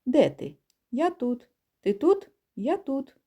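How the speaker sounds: light call and answer, rising high on Ти тут; playful goose roll call; geese calling. Acted. Geese call to each other in flight, light calls. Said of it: "гуси просто перекликалися".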